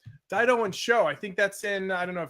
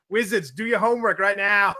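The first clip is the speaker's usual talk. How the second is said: put-on Boston accent; 2 semitones higher, 8 dB louder than usual. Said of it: "with a Boston accent".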